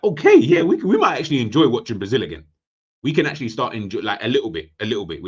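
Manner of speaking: high, loud burst easing down; acted-out fan coming round to it; pleasantly surprised reaction. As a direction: voice a dawning, upbeat realisation. Voicing a reaction to him.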